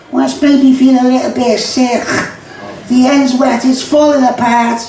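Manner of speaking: feeling sick and giggling; queasy young Scouser. Acted. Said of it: "This is called the kidder conversation".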